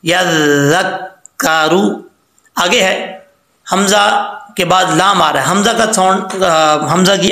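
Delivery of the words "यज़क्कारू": यज़क्कारू slow, with a full, heavy ru; correct recitation. Shown as a demonstration. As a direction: hold the doubled sounds of यज़क्कारू. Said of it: "इसको बारीक नहीं पढ़ेंगे".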